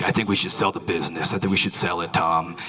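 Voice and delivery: lightly voiced low businessman. Businessman on a call with Tom, slightly put-on low voice. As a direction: drop your pitch for a businessman on the phone. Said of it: "He's a businessman".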